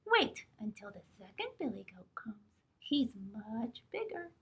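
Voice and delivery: whisper. Acted. Small goat pleading.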